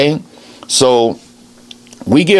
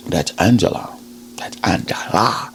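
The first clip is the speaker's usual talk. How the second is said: whisper; 6 semitones lower, 9 dB quieter than usual. Acted. Hushed revelation of her name.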